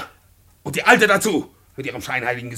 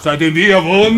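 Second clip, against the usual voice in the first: loud, higher, outraged echo; outraged shout; 4 semitones higher, 11 dB louder than usual. Acted. Tenant echoing the landlord's charge, outraged shout. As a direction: throw his words back, outraged.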